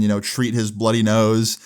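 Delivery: usual speaking voice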